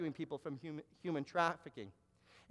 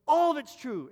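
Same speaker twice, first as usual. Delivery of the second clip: higher and louder, leaning on ALL; emphatic admission; 7 semitones higher, 6 dB louder than usual. Retold or imitated. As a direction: blurt out that every word was right. Man confirms the prophecy was true.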